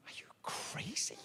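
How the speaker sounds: slow, hushed disbelief; aghast mock whisper. Comic reacting to the egg advice, aghast whisper. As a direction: whisper it slowly as if appalled.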